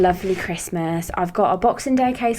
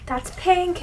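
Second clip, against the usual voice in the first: surprised squeak; 12 semitones higher than usual. Real, not acted. Reacting to makeup colour.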